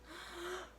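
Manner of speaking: high sharp intake of breath; high gasp. Acted. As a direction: gasp before the plunge. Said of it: "He took a deep breath".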